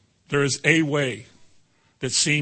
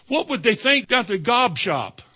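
worried; 7 semitones higher than usual. Retold or imitated. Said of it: "voicing worried believer".

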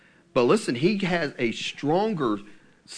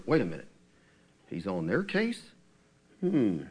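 lower, slowing mock double take; dawning suspicion; 6 semitones lower, 5 dB quieter than usual. Israel realising the prophet means them, lightly acted suspicion. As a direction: act out a wary second thought, pitched down.

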